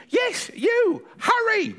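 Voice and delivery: very high, insistent granny voice; high-pitched hurry-up order; urgent granny. Acted. Granny sends boy to answer the door, high urgency.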